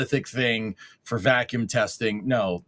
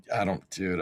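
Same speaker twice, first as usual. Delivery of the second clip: ordinary delivery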